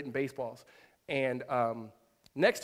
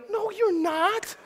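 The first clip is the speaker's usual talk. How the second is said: very high, drawn-out comic retort; hammed-up incredulous objection; mock disbelief; 4 dB louder, much slower than usual. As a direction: squeal it slowly in exaggerated doubt. Acted retort to the little girl.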